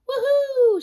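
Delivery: high, loud sung whoop; triumphant sung woohoo; joyful woohoo. Acted. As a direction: whoop it with glee. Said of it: "she sang".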